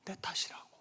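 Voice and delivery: breathy whisper of confession. Real, not acted. Preacher to congregation, whispered. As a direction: drop to a hushed whisper.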